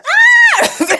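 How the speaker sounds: high shrill startled scream. Retold or imitated. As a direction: squeal like you saw a mouse. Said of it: "he probably said the same thing I said".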